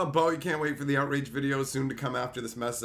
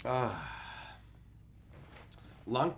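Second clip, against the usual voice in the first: normal talking voice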